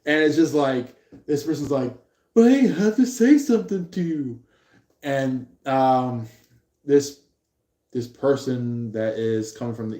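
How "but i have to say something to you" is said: high-pitched, lightly acted pleading; mock insistence. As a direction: play someone insisting on being heard. Insistent plea voiced in retelling.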